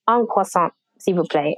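ordering in a French accent. Shown as a demonstration. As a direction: say it like a Parisian customer. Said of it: "pronounce certain words properly".

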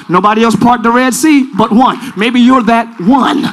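ordinary delivery

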